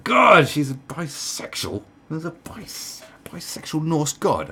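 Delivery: high, drawn-out exasperation; hammed-up obsessive fretting, slowed for effect; mock outrage. Comedian pretending to wake up bothered, mock outrage.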